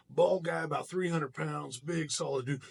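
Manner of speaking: normal talking voice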